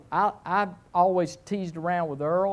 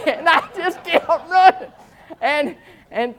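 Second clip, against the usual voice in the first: words broken by real laughter; laughing; 11 semitones higher, 8 dB louder than usual. Real, not acted. Preacher laughing while retelling his escape, laughing. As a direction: chuckle through the line.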